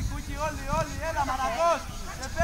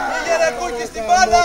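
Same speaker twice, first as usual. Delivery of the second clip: shouted instruction, much louder; loud call-out; 3 semitones higher, 10 dB louder than usual. Real, not acted. Coach to Κούκι about the ball, loud shout. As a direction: shout it across the pitch. Coach calling out during match.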